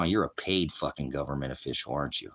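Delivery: normal talking voice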